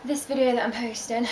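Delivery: breathless after running upstairs; panting between words; out of breath. Real, not acted. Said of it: "sorry I'm out of breath".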